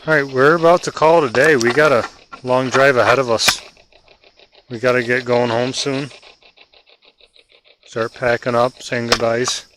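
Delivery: loud call into the dark. Real, not acted. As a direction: project it out to the spirits. Calling out to spirits.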